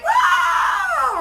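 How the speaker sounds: high, drawn-out startled whoa; mock alarm. Reacting to a sudden pile of problems, startled whoa. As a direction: yelp it like the sky just fell.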